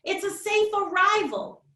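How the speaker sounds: lively, expressive picture-book read-aloud; reading to children. Acted. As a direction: read it like story time for little kids. Author reads her picture book.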